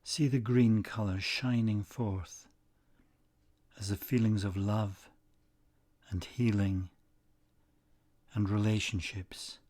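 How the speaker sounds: unhurried, soothing delivery, letting each phrase settle; guided meditation. Acted. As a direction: guide them gently into stillness, phrase by phrase.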